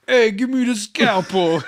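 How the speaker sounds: loud, slow, hammed-up Brooklyn accent. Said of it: "no one sounds like that in Brooklyn".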